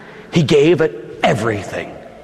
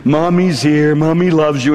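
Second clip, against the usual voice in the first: tender soothing; 3 semitones lower, much slower than usual. Acted. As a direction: comfort a crying child. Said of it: "she'd say".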